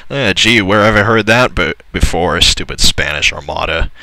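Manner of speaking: ordinary talk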